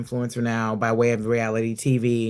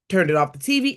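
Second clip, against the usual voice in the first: normal talking voice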